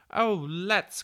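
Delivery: high, loud burst of agreement; eager cry. A narrator's reading. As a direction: jump at it like delighted children. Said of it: "cried the children".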